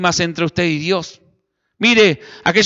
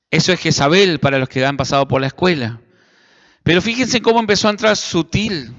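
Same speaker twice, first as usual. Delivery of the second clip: ordinary talk, nothing marked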